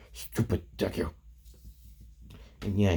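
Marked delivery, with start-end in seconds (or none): 0.1-1.1 softer, grumbled insult; acted sulky grumble; grumbling. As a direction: grumble it under the breath. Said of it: "grumbling. And just being like".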